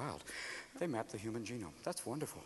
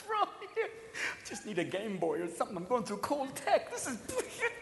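withdrawal whine; 4 semitones higher, 11 dB quieter than usual. Acted. Game Boy craving withdrawal voice.

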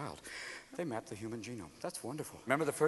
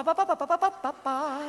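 high sung ringtone imitation; ringtone tune; 7 semitones higher, 3 dB quieter than usual. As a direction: sing the phone's ringtone melody high. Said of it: "but now it's".

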